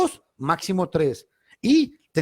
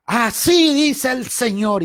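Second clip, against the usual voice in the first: slow, higher, louder grand pronouncement; mock trembling prophet; 8 semitones higher, 4 dB louder, slower than usual. Retelling the church pianist's prophecy, mock grand slow proclamation. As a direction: parody a pianist playing prophet. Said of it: "se para y dice".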